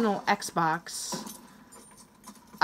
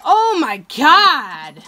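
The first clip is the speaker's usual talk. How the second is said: much louder, slow, drawn-out exclamation; exasperated outburst; 3 semitones higher, 9 dB louder, much slower than usual. Real, not acted. Gamer groans out loud, slow, at a camping enemy. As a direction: stretch it out loud in disbelief.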